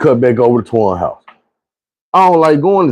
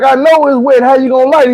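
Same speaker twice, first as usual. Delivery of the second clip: high and louder, baffled pushback; exasperated disbelief; 10 semitones higher, 3 dB louder than usual. Retold or imitated. Arguing with his friend over a soaked joint, high exasperated disbelief. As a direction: come on, how is that even lighting.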